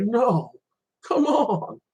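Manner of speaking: high-pitched, softened whine of disappointment; mock dismayed protest. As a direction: protest the wait in a high, pleading voice. Quotes own dismay at long wait.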